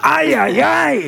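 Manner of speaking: high whooping ay ay ay; high comic yell. Acted. Firing pistols in the air, whooping high.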